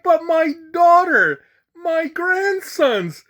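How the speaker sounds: high, loud comic plea; mock pleading. Passenger begging attendant to see his family, mock pleading. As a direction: beg in a drawn-out, hammed-up high voice.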